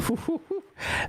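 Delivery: a quick high burst of laughter; bright amused laugh; short laugh. Real, not acted. Reacting to Artemis's message.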